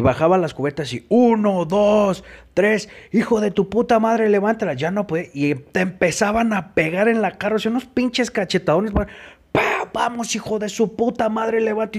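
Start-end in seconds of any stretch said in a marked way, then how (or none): 1.1-4.7 high, drawn-out counting turning into an insult; barking out the count like a drill sergeant; mock guard. Staff counting the reps and cursing the exhausted boy, higher and slowed. 9.9-12.0 higher, sneering command voice; mock guard. Staff slapping the boy and ordering him up, higher mocking tone.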